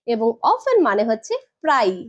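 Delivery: usual speaking voice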